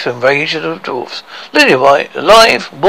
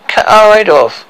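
loud, higher bloodthirsty cry; eager shout; 7 semitones higher, 6 dB louder than usual. Acted. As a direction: bark it out with relish.

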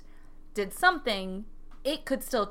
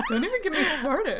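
high, laughing know-it-all huff; hammed-up pedantic exasperation; mock indignation; 6 semitones higher than usual. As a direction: play the worked-up nerd, nearly laughing. Mock-expert rant, pushing up glasses.

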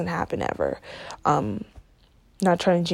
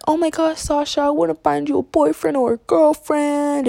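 mocking pushy friends; 7 semitones higher than usual. Friends pushing her to date.

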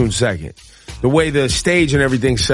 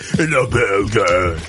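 wrestling voice; 5 semitones lower, much slower than usual. Retold or imitated. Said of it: "That was my wrestling voice".

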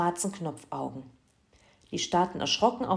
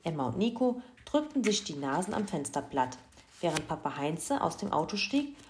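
plain reading voice

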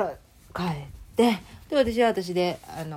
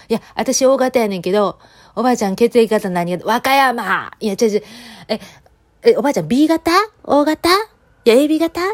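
louder and louder, rising high, to a deaf granny; loud pleading questions; 5 semitones higher, 10 dB louder than usual. Retold or imitated. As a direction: keep shouting, climbing higher each time. Shouting blood type question to granny.

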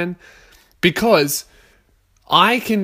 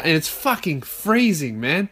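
ordinary talk

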